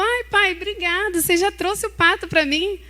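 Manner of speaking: put-on high, delighted boy's voice; a little boy thrilled with his gift; excited child. Boy thanking his father for the duck, high and excited.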